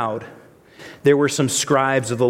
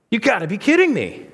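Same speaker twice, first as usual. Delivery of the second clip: exasperated disbelief; 7 semitones higher than usual. Retold or imitated. The disappointed friends, mock incredulous pitch leap. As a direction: voice jumps up high, can't believe it.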